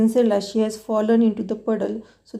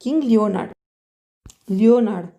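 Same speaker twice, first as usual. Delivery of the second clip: ordinary delivery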